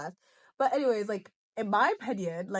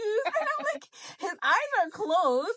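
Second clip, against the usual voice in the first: laughing; 9 semitones higher, slower than usual. Real, not acted. Laughing retelling.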